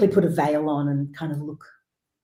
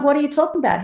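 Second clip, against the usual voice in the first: retold surprise; 9 semitones higher, much faster than usual. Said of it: "I'm like".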